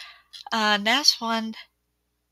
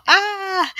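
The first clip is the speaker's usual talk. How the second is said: sudden high scared yelp; startled yelp; 11 semitones higher, 6 dB louder than usual. Retold or imitated. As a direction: jump and yelp in fright. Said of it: "I get really jump. Like, I go".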